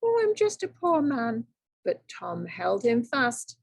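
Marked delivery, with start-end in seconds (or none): 0.0-1.5 high whining plea; a sniveling, pleading leprechaun; whined. Acted. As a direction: wriggle and whimper for pity. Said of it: "wiggled and twisted and whined".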